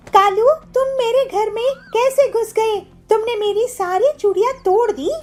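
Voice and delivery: put-on high, alarmed young woman; shocked woman. Pilu catches Kalu smashing her bangles, high alarm. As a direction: cry out in dismay.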